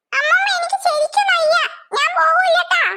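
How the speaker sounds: put-on boy faking illness to his grandmother, whining; faking sick. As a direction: put on a sick voice to skip school. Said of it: "കുറച്ചുകൂടി ഒന്ന് അഭിനയിച്ച് നോക്ക്".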